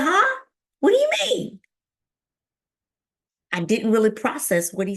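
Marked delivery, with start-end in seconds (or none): 0.0-1.6 very high, loud squeal of confusion; shocked disbelief. Retold or imitated. Patient told to go to hospital, shocked. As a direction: react in high-pitched alarm.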